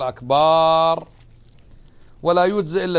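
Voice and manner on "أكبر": "أكبر" dragged very slowly; overlong akbar. Shown as a demonstration. Teacher showing a wrong drawn akbar. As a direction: drag out "أكبر". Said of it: "وخطأ أن تقول".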